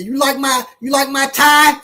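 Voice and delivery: high, eager question; proud stranger. Retold or imitated. Stranger on the street showing off his tie, high and eager. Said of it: "But they say".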